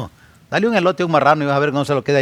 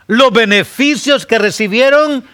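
preaching emphasis; 9 semitones higher, 6 dB louder than usual. Acted.

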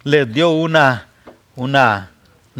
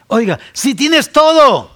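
sudden outburst; 11 semitones higher, 6 dB louder than usual. Acted. Preacher bursts out.

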